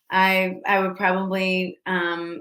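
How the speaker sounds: normal talking voice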